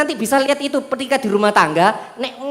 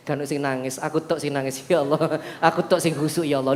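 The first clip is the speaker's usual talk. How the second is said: much lower, softer self-satisfied murmur; smug pious boaster; 9 semitones lower, 8 dB quieter than usual. Retold or imitated. Preacher mocks a worshipper boasting about crying in prayer, low smug murmur. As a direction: purr it, proud of your own piety. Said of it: "Terus noleh".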